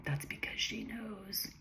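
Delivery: slow, soft near-whisper; whispery and slow, for effect; dramatic hushed reading. Acted. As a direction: drop to a drawn-out, dramatic hush. Author reads her dramatic line aloud.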